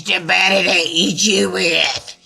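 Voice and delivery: gremlin-ish wolf revealing himself. A narrator's reading. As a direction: snarl it like a little gremlin. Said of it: "That sounded kinda gremlin-like".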